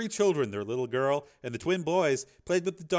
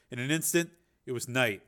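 ordinary delivery

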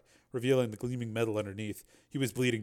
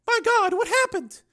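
very high, slow, loud shock; horrified woman; 9 dB louder, slower than usual. Acted. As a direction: gasp it out high in horror. Woman sees his exposed metal.

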